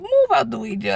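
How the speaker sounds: high, bossy joking command; playful high bossing. Retold or imitated. Telling the husband to move aside, high mock bossiness. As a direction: shoo him aside with a laugh. Retold shove-aside line.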